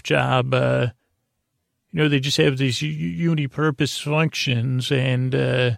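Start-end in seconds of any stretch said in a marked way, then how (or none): none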